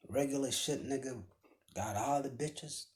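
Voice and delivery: usual speaking voice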